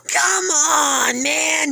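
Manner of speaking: panicked cry. Acted. Percy crying out as the monster catches him. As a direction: cry out as you're grabbed.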